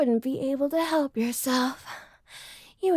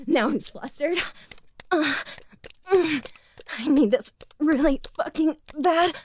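ordinary talk, nothing marked

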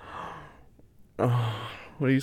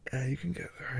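whispering; 4 semitones higher, 9 dB quieter than usual. Real, not acted. Encouraging partner, unvoiced.

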